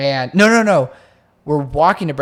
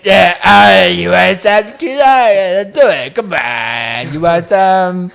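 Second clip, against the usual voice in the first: much louder, higher slurred rush; sloppy drunk Josh; 6 semitones higher, 11 dB louder than usual. Retold or imitated. Friend shows how drunk Josh really sounded to girls, loud and high. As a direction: shout the offer, drunk and sloppy. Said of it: "No, it was like".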